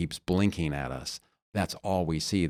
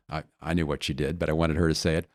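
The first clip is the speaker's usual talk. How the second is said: ordinary talk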